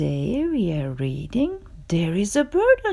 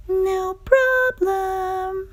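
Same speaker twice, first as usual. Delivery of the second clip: relaxed bird voice; 8 semitones higher, 4 dB quieter, much slower than usual. Acted. Birds agree, leaving.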